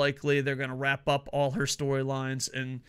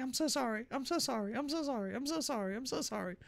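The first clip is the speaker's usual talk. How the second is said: high, soft, cowering apologies; meek apology; 9 semitones higher, 7 dB quieter than usual. Retold or imitated. Imitating the announcer apologizing to his boss, meek and high. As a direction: grovel quietly in a higher voice. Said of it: "And you just hear Michael Cole's end, like".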